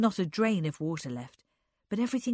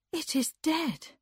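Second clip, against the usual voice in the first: slow, hushed, a touch higher; barely getting the words out after the shock; stunned girl, short of breath; breathless; 9 semitones higher than usual. A narrator's reading. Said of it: "Frances said breathlessly".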